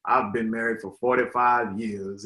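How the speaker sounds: a hint of an old man. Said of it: "Love that voice".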